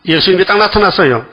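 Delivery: ordinary delivery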